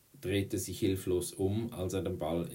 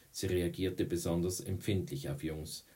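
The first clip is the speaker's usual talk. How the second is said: usual speaking voice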